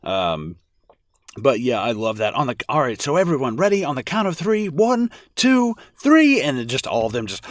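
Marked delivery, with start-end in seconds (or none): 2.7-6.4 rising, ever higher and louder count; excited countdown. Retold or imitated. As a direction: build the count to three. Quoting leader counting down.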